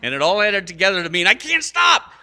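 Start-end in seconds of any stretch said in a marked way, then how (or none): 1.3-2.1 high, loud mock cry of a kid carried away; overexcited child. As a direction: yell it like a child who can't contain himself. Voicing overexcited child with squirt gun.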